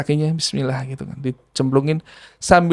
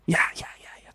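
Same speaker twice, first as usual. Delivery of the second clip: jinn whisper; 13 dB quieter than usual. Retold or imitated.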